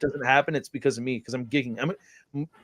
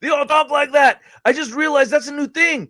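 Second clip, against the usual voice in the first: much higher and louder, worked up; outraged disbelief, voice up high; excited rant; 12 semitones higher, 5 dB louder than usual. Real, not acted. Ranting about how kids talk.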